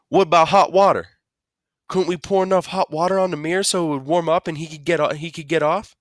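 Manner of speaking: high, urgent suggestion; worried mother. Acted. Mama anxiously asks Dad.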